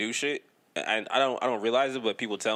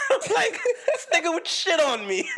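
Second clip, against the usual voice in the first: louder and higher, cracking up mid-sentence; laughing outburst; 3 dB louder than usual. Real, not acted. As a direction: blurt it out through laughter. Laughing about childhood teasing.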